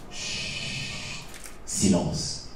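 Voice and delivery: drawn-out shush, then a low, soft word; hushing. A narrator's reading. Narrator silencing the room, hushed. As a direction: hush them down to near silence.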